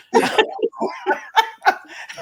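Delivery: high breathy laugh; burst of laughter. Real, not acted. Retelling the birthday plate story, a high laugh.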